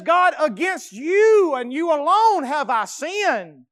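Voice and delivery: high, loud, slow confession; anguished confession. Acted. David confesses to God, loud and slow. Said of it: "He says".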